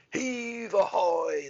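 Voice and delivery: slow, high, loud sailors' call; shouted. Acted. Sailors shout as each chest comes up. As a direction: haul it out like a work chant. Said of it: "they shouted".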